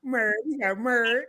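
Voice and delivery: put-on teasing copy of her country accent; imitated country accent. Interviewer echoes the guest's pronunciation of "married", imitated country accent. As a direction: quietly mimic a country "married". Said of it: "my accent".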